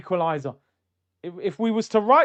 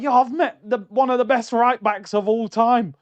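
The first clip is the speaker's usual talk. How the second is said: high, louder hyped boast; 6 semitones higher, 6 dB louder than usual. Retold or imitated. Fan boasting to his friend about meeting Cafu, hyped and loud. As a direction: brag like you met a legend. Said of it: "I was just hyped".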